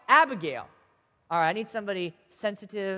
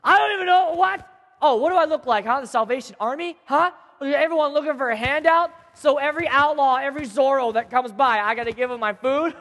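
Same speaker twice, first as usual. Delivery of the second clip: raised, higher-pitched scornful rant; indignant yelling; 4 semitones higher, 4 dB louder than usual. Acted. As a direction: berate them like a grouchy villain. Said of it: "yelled at them".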